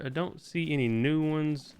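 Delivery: ordinary talk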